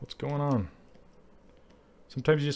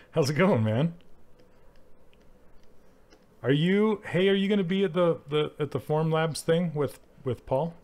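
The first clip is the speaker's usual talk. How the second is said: higher, louder excited dude voice; hammed-up dude greeting an old friend; mock chatty buddy; 6 semitones higher, 5 dB louder than usual. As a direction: greet him like an eager bro. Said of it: "He spews breadcrumbs when he talks".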